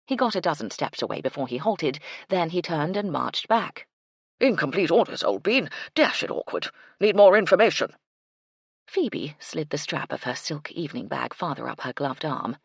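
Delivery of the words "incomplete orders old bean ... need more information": loud military report; blustery colonel. A narrator's reading. Colonel back for more orders, blustering. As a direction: report back like an old army officer.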